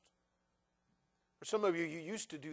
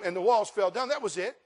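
loud, high, fervent preacher's cry; full-force preaching; 5 semitones higher, 12 dB louder than usual. Acted. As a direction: preach it to the back row at full voice.

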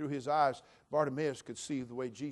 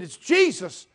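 high, shouted news building in volume; excited announcement; 13 semitones higher, 20 dB louder than usual. Retold or imitated. Crowd telling blind beggar who is passing, excited shouting. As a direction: shout it out as the crowd, rising to a peak.

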